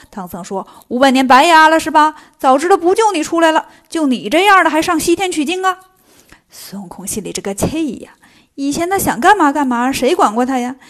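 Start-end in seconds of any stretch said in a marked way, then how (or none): 0.9-5.8 loud, scornful telling-off; acted exasperated scolding, forceful and insistent; nagging tirade. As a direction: cut the disciple off and berate them. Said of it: "现在见唐僧絮絮叨叨".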